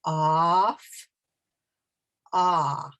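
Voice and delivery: Off, then only the open ah alone; stop on the vowel. Shown as a demonstration. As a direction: cut off at its vowel. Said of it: "but stop".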